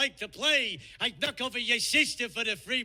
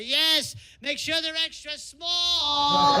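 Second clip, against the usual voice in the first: higher, louder, slow shouted taunt; yelling from the window; 7 semitones higher, 5 dB louder, much slower than usual. Retold or imitated. Wife shouting down to her husband, slow mock yell. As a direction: bellow the punchline down the stairwell, drawn out.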